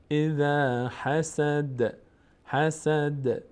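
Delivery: حسد with a bouncing د; slow recitation; qalqala on د. Shown as a demonstration. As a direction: let the د echo.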